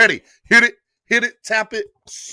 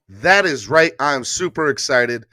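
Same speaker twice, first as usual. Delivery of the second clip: usual speaking voice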